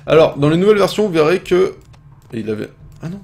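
ordinary talk

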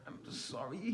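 soft, tiny trembling apology; quiet, near tears; tearful sorry. A narrator's reading. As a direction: barely get the words out. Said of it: "His lip began to tremble".

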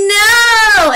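high, loud mock no; children's shout; kids shouting no. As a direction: play the class yelling back. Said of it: "they will say".

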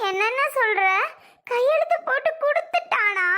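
high-pitched, incredulous questioning; astonished disbelief. Acted. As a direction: react in amazement, pitch leaping up. Learns the papers were signed.

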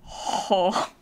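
normal talking voice

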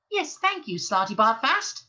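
high, curt mouse dismissal; sharp. A narrator's reading. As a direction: cut him off sharply. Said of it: "said Benji Mouse sharply".

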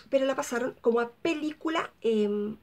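ordinary delivery

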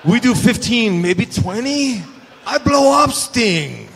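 broken English impression. Muta tells Sting he gets tired fast, in laughing broken English. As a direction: exaggerate Muta bargaining down the match length. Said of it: "Oh, no".

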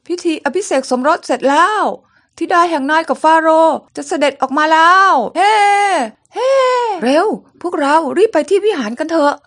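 rally the crowd to the temple; excited cheering. Acted. Crowd cheers the royal wedding. (ตะโกนเชียร์อย่างตื่นเต้น เสียงสูงและดังขึ้น)

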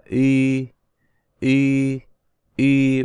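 long Y said as ü, lips rounded, some air through the nose. Shown as a demonstration. Teacher models U with two dots, Y held with rounded lips. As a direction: hold Y steadily with rounded lips, like ü. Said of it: "cái hơi nó có đi ra mũi".

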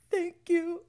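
high, gasping fake crying thank-you; hammed-up teary gratitude; mock sob. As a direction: gasp then sob the words. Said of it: "he could just be like".

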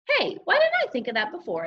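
light up with a new idea; excited realization. A narrator's reading. Boy telling himself about a new idea, excited.

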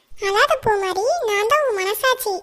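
ordinary talk, nothing marked

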